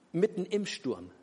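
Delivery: usual speaking voice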